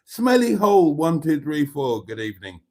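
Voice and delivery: normal talking voice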